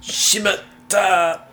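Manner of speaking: hammed-up anime gasp, high and slow; having a heart attack. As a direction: clutch your chest and cry out.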